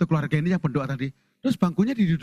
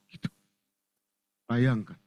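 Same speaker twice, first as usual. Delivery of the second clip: normal talking voice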